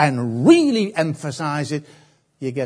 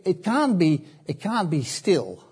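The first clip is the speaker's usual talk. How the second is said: normal talking voice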